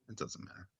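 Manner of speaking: near-silent whispered dismissal; under his breath. Real, not acted. Host drops his speculation about the picture, whispering. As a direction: drop it to a whisper, as if to yourself.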